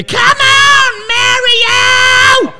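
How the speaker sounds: put-on high, loud old lady cheer. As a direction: shriek it like a little old lady fan. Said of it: "And a little old lady".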